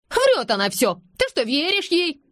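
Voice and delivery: high, incredulous outburst; indignant accusation. A narrator's reading. Anush accuses Nazeli of lying, high and indignant. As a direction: protest as if nobody could believe her.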